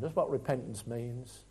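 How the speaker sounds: ordinary talk, nothing marked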